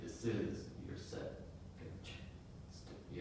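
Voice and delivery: This is your set, kunch, ans tu read slowly, dropping away into a hush; slow paused reading. Shown as a demonstration. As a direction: echo the heartbeat rhythm softly. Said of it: "the heartbeat is great".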